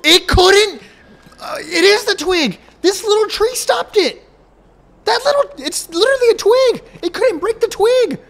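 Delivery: high, slow outraged whine; exasperated. Pretend. Mock outrage at weak tractor.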